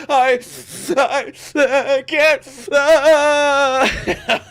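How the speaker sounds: hammed-up kid sob; mock crying kid. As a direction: imitate a sobbing child who can't speak. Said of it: "when they get all like".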